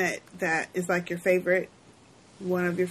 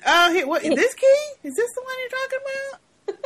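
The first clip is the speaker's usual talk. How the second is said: high mock innocent voice. Acted scene quote, guard.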